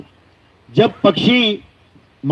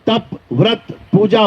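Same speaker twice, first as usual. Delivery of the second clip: ordinary talk, nothing marked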